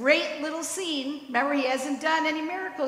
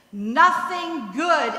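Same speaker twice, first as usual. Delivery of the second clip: quoted scorn; 6 dB louder, much slower than usual. Retold or imitated. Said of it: "and I quote".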